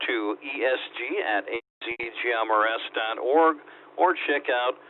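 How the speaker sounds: usual speaking voice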